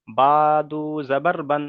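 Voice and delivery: slow, drawn-out "বা দু জাবার বান"; alif left silent. Shown as a demonstration. Teacher showing students the correct reading, alif left silent. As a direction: stretch বা and দু, going straight from one to the other. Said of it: "এখানে কেমন পড়তে হবে?".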